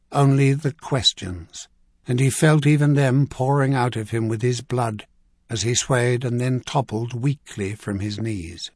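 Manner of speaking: ordinary delivery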